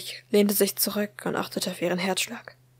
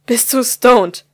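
slow, louder, every word weighed, stress on du; slow menacing interrogation; 4 semitones higher, 12 dB louder, slower than usual. Acted. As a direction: drag it out like a final warning. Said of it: "fragte ihre Mutter sie nun schon zum vierten Mal".

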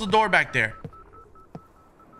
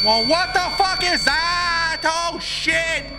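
high, loud, drawn-out frightened yell that breaks into a shout; terrified yell; 12 semitones higher, 5 dB louder, slower than usual. Real, not acted. Player yells at the monster leaping out, terrified. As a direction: recoil and yell at the sudden monster. Jumpscare in horror game.

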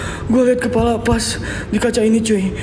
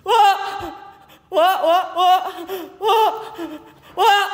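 frightened calling out; 10 semitones higher, much slower than usual. Real, not acted. Calls out to friend in fright.

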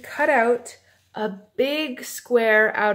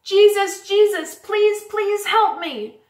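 high, loud, insistent cry; storybook beggar yelling at full voice; louder pleading shout; 8 semitones higher, 6 dB louder than usual. A narrator's reading. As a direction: shout out desperately for help, stretching the last plea. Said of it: "But Bartimaeus shouted louder".

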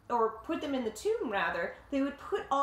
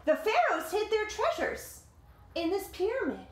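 lively read-aloud voice, higher than the chat; story narration; 8 semitones higher, 3 dB louder than usual. A narrator's reading. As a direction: tell it like a picture book to kids. Reading the picture book aloud.

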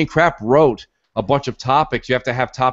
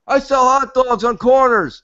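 high, slow goofy vendor voice; hot dog vendor voice; 11 semitones higher, 3 dB louder than usual. Retold or imitated. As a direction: play a dopey street vendor.

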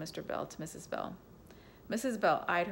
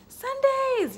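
much higher, loud joyful shout; gleeful yell; 12 dB louder than usual. Acted. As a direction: shriek it with pure kid delight. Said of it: "yelled Amanda gleefully".

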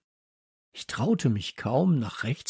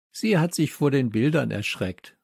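ordinary delivery

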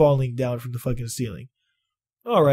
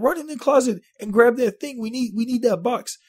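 high urgent imitation of his boss; 9 semitones higher than usual. Imitating his boss sending him into the closet, high urgent. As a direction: push him to hurry, voice pitched up. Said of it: "She said".

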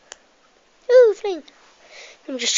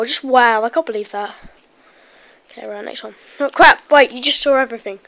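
normal talking voice

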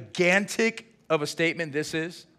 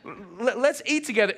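eager invite; 5 semitones higher, 3 dB louder than usual. Retold or imitated. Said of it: "And you say".

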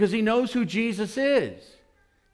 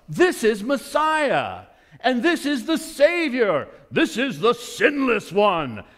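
awed proclamation; 5 semitones higher, 5 dB louder, slower than usual. Acted. John proclaims Jesus to crowd.

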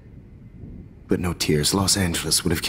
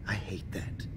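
weary whispered aside; 12 dB quieter than usual. Acted. Sighs after outburst.

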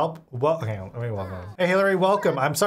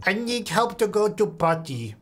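slow, higher put-on little-kid voice; put-on toddler talk; 4 semitones higher than usual. Toddler asks for potty help, slow put-on voice. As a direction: play a toddler asking slowly for help. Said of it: "I can talk like this".

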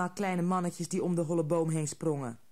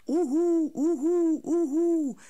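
loud high repeated hooting voices; many little voices hooting in a row; chorus of hoots; 11 semitones higher, 7 dB louder, slower than usual. A narrator's reading. Little voices outside mimicking the owl, loud hooting. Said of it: "zeiden allemaal stemmetjes buiten".

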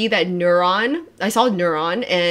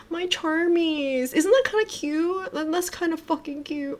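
high, soft coo; cutesy cooing; 7 semitones higher, 5 dB quieter than usual. Real, not acted. Streamer trying a pet name for fans, cutesy cooing. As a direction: coo over something adorable.

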